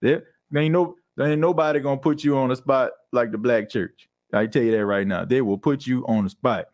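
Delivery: ordinary talk, nothing marked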